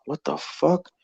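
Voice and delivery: retold quiet shock; stunned disbelief. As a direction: say it softly, baffled. Stops dead as dog talks.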